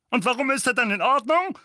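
high loud outburst; furious uncle flares up; flaring up. A narrator's reading. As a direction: blow up at him. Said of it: "brauste Onkel Vernon auf".

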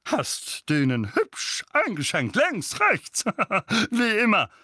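slow then high, laughing bluster; proud chuckle; father boasts about his son's fists; chuckling boast. A narrator's reading. Vernon praises son chuckling.

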